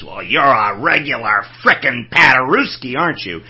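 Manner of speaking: put-on gruff, loud growling old man; bulldog growl. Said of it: "sounded like a bulldog growling".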